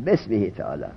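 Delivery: "بسمه تعالی" joined as besmihi, low and soft; without alef. Shown as a demonstration. Teacher gives in to critics, "بسمه" said low as joined besmihi. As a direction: drop the e before s in "بسمه تعالی", quietly. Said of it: "بی الف نوشتم".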